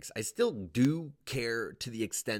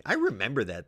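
usual speaking voice

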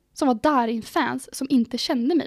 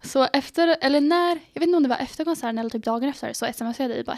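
ordinary talk, nothing marked

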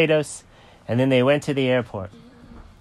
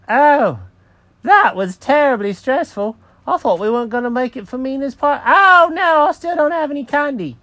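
high, loud cartoon cat building to alarm; Jenkins the cat, stressed; 14 semitones higher, 7 dB louder than usual. Cat remembers he has no candy, high stressed outburst. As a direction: fret loudly in a high voice, then wail.

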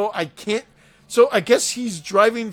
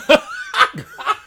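shrill laughing; high burst of laughter; 5 dB louder than usual. Real, not acted. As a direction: cackle high. Laughing hard.

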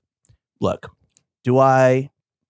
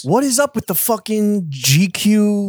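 mock outrage; 9 semitones higher than usual. Outraged mock complaint about GQ.

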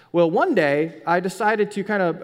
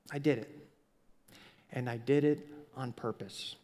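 quiet confession; 5 semitones lower, 8 dB quieter than usual. Pretend. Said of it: "is to just say".

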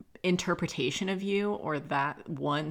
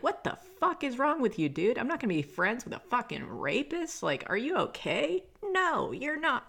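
outraged scolding; 9 semitones higher than usual. Retold or imitated.

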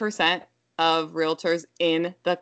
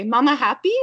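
put-on high, louder toddler question; little child; 6 semitones higher, 6 dB louder than usual. Toddler checks on irritated mom, high questioning child voice. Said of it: "she'll look at me and say".

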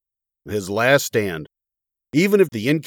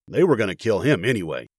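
ordinary delivery, nothing marked

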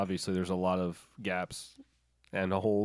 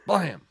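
gunshot noise; 9 semitones higher, 10 dB louder than usual. Pretend. Gunshot sound effect.

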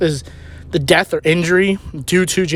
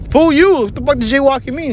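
raised, high-pitched retold retort; indignant comeback; 9 semitones higher, 4 dB louder than usual. Arguing with his cousin over jaywalking, indignant. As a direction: fire it back high, starting loud.

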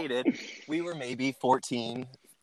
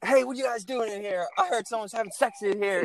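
suspicious friend voice; 7 semitones higher than usual. Retold or imitated. Steve voiced in story.

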